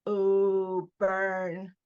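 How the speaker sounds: drawn-out teasing "ooh, burn"; teasing jeer. A narrator's reading. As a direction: jeer like one of the boys. Boys jeer at a put-down.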